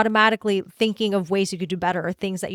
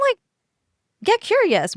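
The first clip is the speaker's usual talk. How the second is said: ordinary talk, nothing marked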